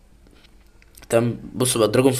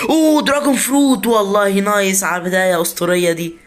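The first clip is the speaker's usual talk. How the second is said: high, loud burst of delight; excited shout; 7 semitones higher, 4 dB louder than usual. Real, not acted. Gamer shouting to viewers at a rare seed, high and loud. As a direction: yelp with joy at the rare find.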